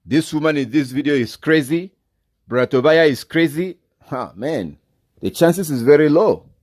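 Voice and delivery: incredulous rising challenge. Real, not acted. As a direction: pile it on until it sounds absurd.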